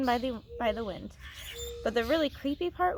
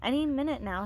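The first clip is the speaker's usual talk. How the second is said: usual speaking voice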